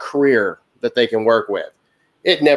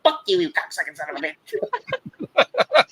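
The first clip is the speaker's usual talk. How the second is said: high, squawked mock tic outburst; Earl; 6 semitones higher, 4 dB quieter than usual. Driver imitating Earl greeting customers, sudden Tourette's outburst. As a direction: burst suddenly into strained, high-pitched involuntary cursing. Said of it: "he's got the whole twitch going".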